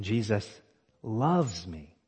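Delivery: "loves" set off by a pause and leaned on; stress on loves. Shown as a demonstration. Preacher repeating the sentence for emphasis, stress on "loves". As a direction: pause after "Jesus", then dwell on "loves". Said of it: "emphasizing a different word each time".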